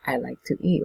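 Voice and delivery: ordinary delivery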